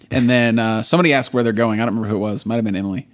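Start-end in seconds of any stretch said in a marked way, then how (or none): none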